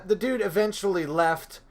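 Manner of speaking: ordinary delivery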